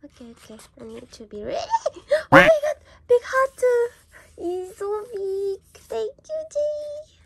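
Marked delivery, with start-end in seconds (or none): 2.3-7.1 very high, loud gasping squeal; overwhelmed squeal. Real, not acted. Fan seeing Jay's big heart, overwhelmed squeal. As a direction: gasp and squeal, overwhelmed with delight.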